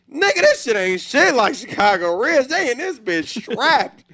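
loud, high-pitched hyped outburst; hyped amazement. Retold or imitated. Traveler reliving the mall to his friends, hyped and loud.

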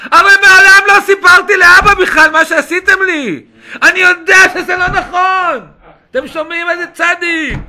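loud, high, fervent protest; full-force preaching. Acted. Preacher voicing Joseph insisting he never told father, fervent shout. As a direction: shout it out with conviction.

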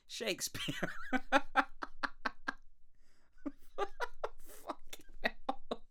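words dissolving into laughter; laughing. Real, not acted. Host cracking up at his own rant, laughing. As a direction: laugh through the words, helpless.

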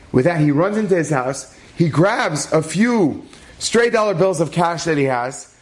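normal talking voice

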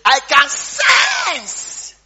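loud, high, dragged-out mock prophet; mock prophet shout. Lady imitated claiming anointing, hammed-up loud. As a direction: proclaim it like an overblown prophet. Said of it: "The lady have not come there to say".

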